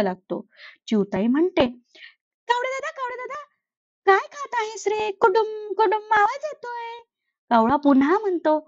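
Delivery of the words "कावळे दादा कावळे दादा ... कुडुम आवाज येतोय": sweet and busy, put him off gently; busy mother sparrow. Acted. Sparrow answers the crow while bathing her baby, high and sweet.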